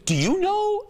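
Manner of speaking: high, rising question as mom; imitated puzzled mom. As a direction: ask it high, like a clueless mom. Mom asking son for answer.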